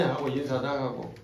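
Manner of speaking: ordinary talk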